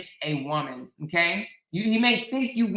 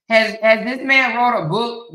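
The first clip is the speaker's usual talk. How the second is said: ordinary talk, nothing marked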